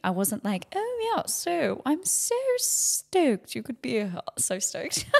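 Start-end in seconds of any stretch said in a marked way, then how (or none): 0.8-4.2 high, drawn-out put-on posh voice; mock gushing in a posh accent, slowly; hammed-up posh English. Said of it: "I don't know why I went posh".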